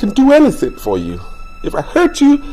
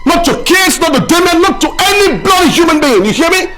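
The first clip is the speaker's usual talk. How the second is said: furious shout; 11 semitones higher, 4 dB louder than usual. Acted. Husband shouting at wife who wants to leave him, furious. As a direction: shout it at her, high and loud.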